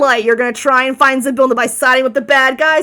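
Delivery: loud sarcastic disbelief; dude-voiced bird. Bird doubting the party can find Zybilna, loudly scornful. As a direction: scoff as an indignant bird. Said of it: "I have to do a dude voice".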